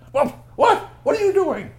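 high, loud panicked squawk; storybook character caught off guard; startled outcry. Acted. Startled character with cat on head.

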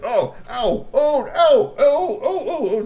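high loud ows; mock ow cries. Trolls being whacked by guards, high yelps. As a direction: yelp at each whack.

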